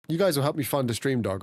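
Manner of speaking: normal talking voice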